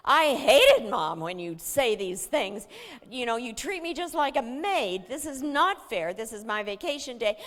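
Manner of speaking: put-on loud, high furious teenage rant; loud, high teen tirade; angry rant. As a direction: unload all your anger on mom. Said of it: "unloaded all of her anger".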